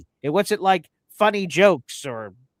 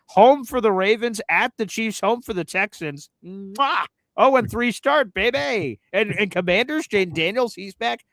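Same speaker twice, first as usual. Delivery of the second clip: gloating; 6 semitones higher than usual. Real, not acted. Gloating through rival schedule.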